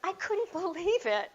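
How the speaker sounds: high, loud burst of astonishment; loud disbelief. Real, not acted. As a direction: exclaim it in utter disbelief. Her amazement after Trudy quit.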